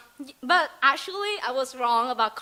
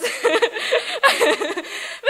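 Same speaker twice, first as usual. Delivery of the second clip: laughing; 10 semitones higher, 4 dB louder than usual. Real, not acted. Laughing mid-presentation.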